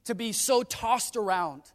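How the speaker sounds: loud, high, emphatic preacher delivery; full-force preaching. Real, not acted. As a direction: preach it out to the whole congregation.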